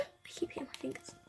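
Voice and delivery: breathy whisper to self; whispered complaint. Real, not acted. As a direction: mutter it under breath. Aside after hurting fingers.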